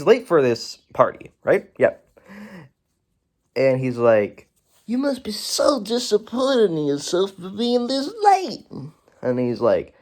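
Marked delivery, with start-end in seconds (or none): 4.9-8.7 high-pitched mock scolding voice; character impression. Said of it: "That's literally how he talks".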